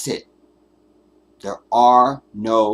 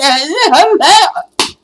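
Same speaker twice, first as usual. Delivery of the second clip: much higher, louder mocking dainty voice; dainty overpronounced son; 12 semitones higher, 11 dB louder than usual. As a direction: ham up a prissy overpronouncing son. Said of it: "his son sounds like this".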